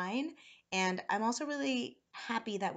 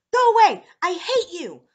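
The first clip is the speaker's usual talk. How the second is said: very loud, high-pitched outburst; angry shout; 11 semitones higher, 20 dB louder than usual. A narrator's reading. Boy pushes grandfather away, angry shout. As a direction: shout it as a child in a tantrum. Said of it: "On shouted".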